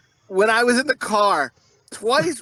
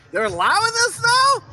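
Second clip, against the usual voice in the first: high, incredulous question; squeaky-high mock outrage; mock disbelief; 4 dB louder than usual. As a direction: replay your scandalized astonishment. Replays own disbelief at radio.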